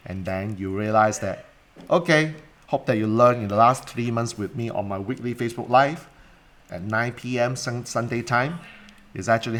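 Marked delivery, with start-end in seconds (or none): none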